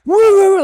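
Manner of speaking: high, loud mock barking woofs; frantic dog noises, hammed up; imitated dog outburst.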